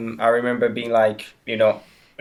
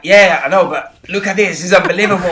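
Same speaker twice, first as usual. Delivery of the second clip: higher and louder, carried away by the crowd; a fan swept up by a rocking stadium; excited outburst; 5 semitones higher, 6 dB louder than usual. Retold or imitated. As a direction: blurt it out in thrilled disbelief. Retelling his thrill at rival stadium.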